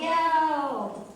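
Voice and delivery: "yelled" stretched long and pitched high; drawn-out mock yell. Storyteller showing dad yelling, "yelled" drawn out high.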